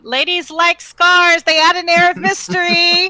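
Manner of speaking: shouting. Acted. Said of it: "Keneal shouts to Utini".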